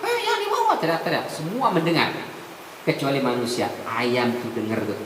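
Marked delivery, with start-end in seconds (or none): 0.0-0.7 very high frightened pleading; screaming not to be carried away; hammed-up terrified cry. Teriak, dia teriak.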